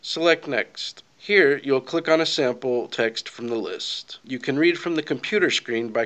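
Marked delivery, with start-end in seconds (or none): none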